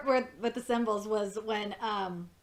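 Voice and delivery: ordinary delivery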